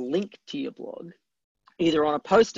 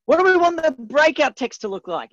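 high, loud mock holler; pitched up and projected, like a shouted question; imitated calling out; 9 semitones higher, 6 dB louder than usual. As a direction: play a typesetter calling out to the team. Acting typesetters calling out a question.

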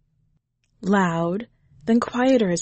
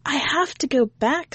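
put-on lightly raised child voice; a little boy reasoning; 8 semitones higher than usual. A narrator's reading.

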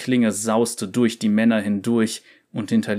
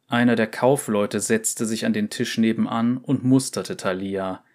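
ordinary delivery

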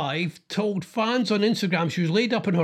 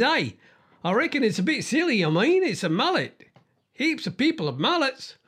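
put-on Australian accent; 7 semitones higher than usual. Host quoting the Aussie pub-goer, in a mock Australian accent. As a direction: play the mullet-loving Aussie bloke in an Australian accent. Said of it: "try and do an Australian".

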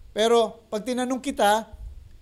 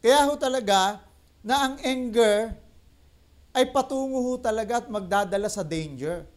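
usual speaking voice

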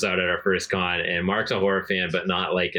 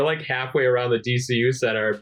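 ordinary talk, nothing marked

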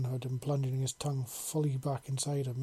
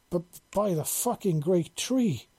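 higher, louder, slow burst of shock; startled exclamation; 5 semitones higher, 4 dB louder than usual. Acted. Dragon woman is startled mid-moment, crying out loud and high. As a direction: blurt it out, caught off guard. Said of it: "she exclaimed suddenly".

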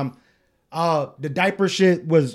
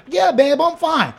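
higher-pitched imitation of the partner; partner's reply; 11 semitones higher, 4 dB louder than usual. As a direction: pitch it up as the partner brushing off concern. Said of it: "She like".

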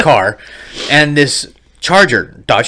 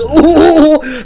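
laughing mock meme noise; 11 semitones higher, 3 dB louder than usual.

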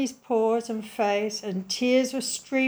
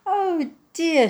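a high, louder sad sigh of a word; 8 semitones higher, 5 dB louder than usual. A narrator's reading. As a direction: sigh it out, disappointed. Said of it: "she said sadly".

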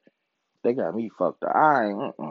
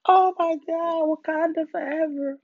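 mocking mourners; 13 semitones higher than usual. Fans mourning Chadwick Boseman, high and dramatic. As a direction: whine it like a fake fan. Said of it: "these people sad as fuck".

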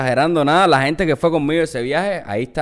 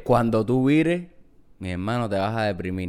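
mock ominous warning; 6 semitones lower, 7 dB quieter than usual. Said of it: "lo que siempre te dice la gente".

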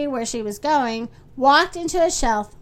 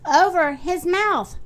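plain reading voice

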